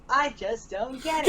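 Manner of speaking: puzzled slow character line. Retold or imitated.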